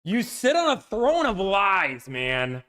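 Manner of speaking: slow, high mock accusation; mock outrage. Dealer says car already reserved.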